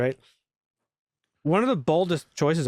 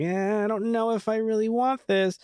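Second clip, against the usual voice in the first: mock whiny Superman; 7 semitones higher than usual. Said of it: "was like".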